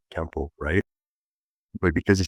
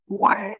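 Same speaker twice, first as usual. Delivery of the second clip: mock impact yell; 5 dB louder than usual. Sound effect of a strike.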